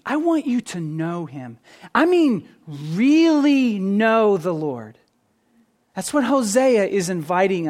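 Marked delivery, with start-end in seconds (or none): 0.1-5.0 earnest plea with slowed emphasis; urgent emphasis. Retold or imitated. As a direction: press hard on "really", stretching the phrase out. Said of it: "it's like Hosea is saying".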